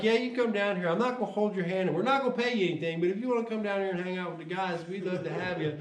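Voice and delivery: lightly voiced keen boss; eager invitation. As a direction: voice a boss who's suddenly all in. Employer invites him warmly.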